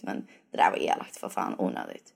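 muttered aside. Real, not acted.

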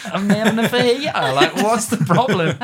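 high, loud and laughing, playing it casual; loud, giggling mock nonchalance; mock innocent. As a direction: answer the shriek as if nothing is wrong. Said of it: "And I was like".

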